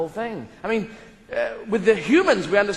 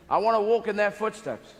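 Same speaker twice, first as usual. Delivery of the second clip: ordinary delivery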